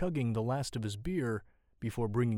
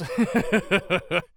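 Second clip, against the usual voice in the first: loud, high boozy cackle; rapid, hammed-up drunk laugh; drunken chuckle; 8 semitones higher, 11 dB louder than usual. A narrator's reading. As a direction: chuckle loudly like a tipsy rogue. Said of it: "The man chuckles drunkenly".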